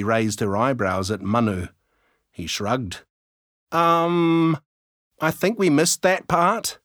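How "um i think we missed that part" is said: sheepish admission. A narrator's reading. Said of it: "Sophie admitted".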